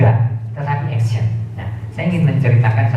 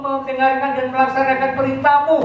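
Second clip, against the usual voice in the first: eager pleading promise. Acted. Climber eagerly promises obedience.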